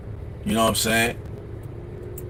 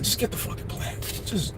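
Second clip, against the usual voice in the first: whispered under the breath, giving up; exasperated whisper; 6 semitones higher, 11 dB quieter than usual. Retold or imitated. Boyfriend muttering about wife's plant, whispered. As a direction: hiss it to yourself, fed up. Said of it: "You're just like".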